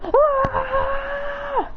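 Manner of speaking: high shrill yelp of fright; startled cry. Acted. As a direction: yelp as the blast flies past. Kid dodging a blast.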